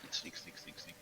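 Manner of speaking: soft, whispered sneaking chant; hushed, repeated little stealth whisper; playful sneaking whisper. Pretend. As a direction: whisper it like tiptoeing along. Acting out the party sneaking.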